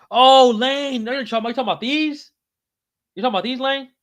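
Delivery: high, loud, thrilled hello; excited greeting. Real, not acted. Excited greeting to viewer Lane.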